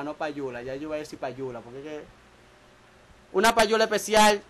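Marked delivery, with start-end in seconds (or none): 3.3-4.4 louder, boasting shout-out; hyped announcement. Acted. Hypes his shout-out to the camera, louder. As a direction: call it out like a radio host.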